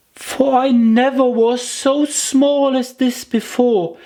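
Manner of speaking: put-on high childlike voice, stressing never; a little girl complaining it's too bad; high little girl, aggrieved; Alice, upset. A narrator's reading. Alice laments being small.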